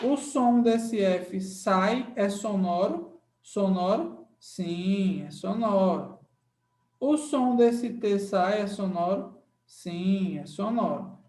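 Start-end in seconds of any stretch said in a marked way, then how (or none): none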